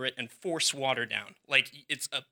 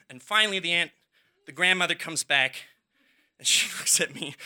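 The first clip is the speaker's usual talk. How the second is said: ordinary talk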